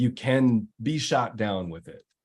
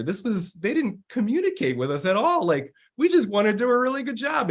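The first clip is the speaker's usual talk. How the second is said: higher, animated voice of a frustrated teammate; upset teammate; 9 semitones higher than usual. Retold or imitated. Teammate telling the team he is angry too, higher and animated. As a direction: vent it up high, fast at the end.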